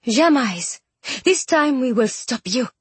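understated dark defiance; said darkly. A narrator's reading. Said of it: "she said darkly".

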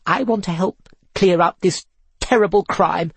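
lightly played sugary girl. Tiffany's sugary voice.

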